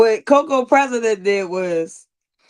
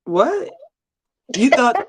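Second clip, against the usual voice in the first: ordinary delivery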